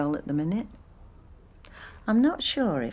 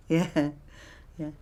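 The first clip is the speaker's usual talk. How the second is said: usual speaking voice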